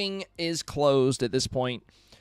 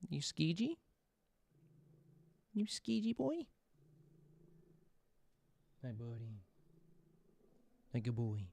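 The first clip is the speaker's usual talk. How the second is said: softer playful pet talk; cooing to the cat; 13 dB quieter than usual. Real, not acted. Owner talking to his yawning cat, soft playful coo. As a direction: gently tease a sleepy cat.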